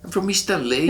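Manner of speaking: put-on high-pitched Chicano accent; Louis. Shipmate explaining where he is from, Chicano accent. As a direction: proudly play up the East L A Chicano accent. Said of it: "You have this accent as well".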